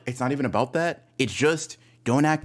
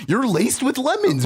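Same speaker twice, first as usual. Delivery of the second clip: much higher, mocking disbelief; incredulous; 9 semitones higher than usual. Teasing his friend about lemon water, much higher. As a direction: squeak it out in mock shock. Repeats the jab, then again.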